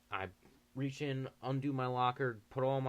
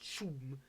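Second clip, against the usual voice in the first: whoosh sound; 4 semitones higher, 6 dB quieter than usual. Retold or imitated. Sound of speeding away.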